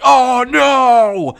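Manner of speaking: high, loud cry of dismay; hammed-up alarmed shout; mock panic. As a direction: shout it like a disaster just struck. Acted cry of dismay in game.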